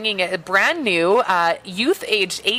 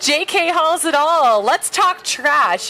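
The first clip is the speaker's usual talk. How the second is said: ad read; 8 semitones higher, 3 dB louder, slower than usual. Acted. Ad read on air.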